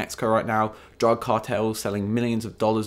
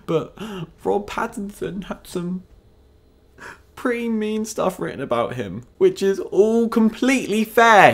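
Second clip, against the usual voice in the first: high, gasping, sarcastic pity; mock sympathy; 9 semitones higher than usual. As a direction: gasp and coo over poor Rob in sarcastic pity.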